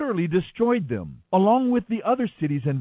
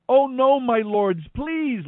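higher pitched, louder desperate plea; begging; 8 semitones higher, 4 dB louder, slower than usual. A narrator's reading. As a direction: beg for mercy, pitched up. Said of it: "Lot begged".

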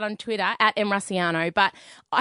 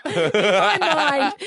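laughing through the words; giggling, can barely get it out; 3 semitones higher, much slower than usual. Real, not acted.

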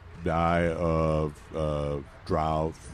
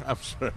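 snorting laughs; 7 semitones higher, 6 dB quieter than usual. Real, not acted. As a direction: snort of laughter, then apology. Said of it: "did I just snort?".

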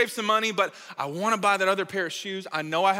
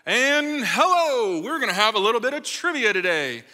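store intercom announcer; 3 semitones higher, 3 dB louder than usual. Retold or imitated. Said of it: "over the intercom, somebody comes on".

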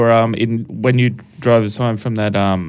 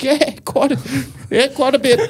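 higher, half-laughing reply; sheepish admission; 14 semitones higher than usual. Retold or imitated. Comic answering a polite heckler, in a higher sheepish tone. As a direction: own up to the heckler lightly. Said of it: "It's like".

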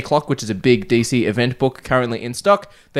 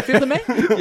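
laughing question; 11 semitones higher than usual. Real, not acted. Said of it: "Your eyebrows dropped".

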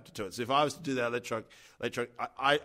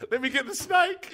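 laughing high quote; 4 dB louder than usual. Retold or imitated. Retelling the masseuse to a friend, laughing. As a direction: squeal it through laughter.

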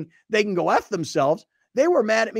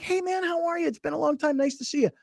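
polite fake greeting; 10 semitones higher, 5 dB quieter than usual. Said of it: "I'm like".

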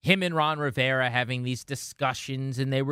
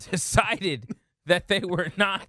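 laughing; 4 semitones higher, slower than usual. Real, not acted. Laughing at a co-host's joke.

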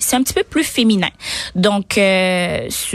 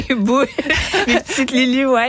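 laughing; 5 semitones higher than usual. Real, not acted. Amused by her own avatar.

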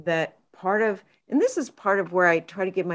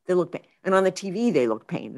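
ordinary talk

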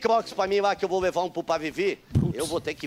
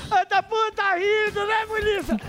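much higher and slow, drawn-out ribbing; sing-song teasing; 13 semitones higher, slower than usual. Pretend. Calling out the policeman laughing at him, slow and high. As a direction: stretch it out high like a playground tease.

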